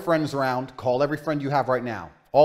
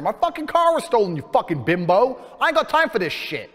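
retold angry outburst; 5 semitones higher than usual. Insulting the officer over his stolen car, high and furious. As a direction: let it rip at her.

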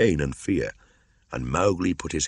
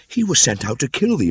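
much higher and louder, alarmed; crying out; 10 semitones higher, 7 dB louder than usual. Acted. Mother warning her son, crying out in alarm. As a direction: warn him urgently. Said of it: "Meswa cried".

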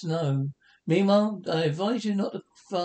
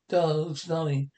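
usual speaking voice

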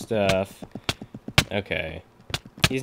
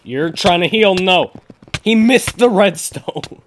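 high, loud gamer exclamation; excited outburst; 7 semitones higher, 6 dB louder, slower than usual. Real, not acted. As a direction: shout it out mid-fight, raised pitch. Excited play in game.

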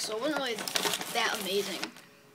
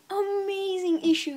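high, loud, slow gushing praise; excited; 8 semitones higher, 7 dB louder, slower than usual. Real, not acted. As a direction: stretch it out with real excitement.

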